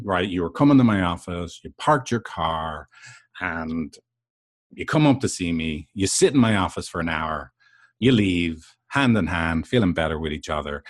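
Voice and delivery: normal talking voice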